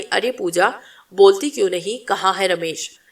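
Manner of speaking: ordinary delivery, nothing marked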